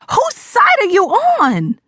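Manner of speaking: slow high scream of outrage. Acted. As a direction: hurl it at mother, betrayed and furious. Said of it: "I screamed".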